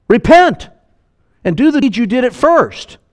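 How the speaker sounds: high and loud, cry out repent; commanding call. Acted. Preacher voicing Jesus calling the church to repent, loud and high. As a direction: thunder the command to the congregation.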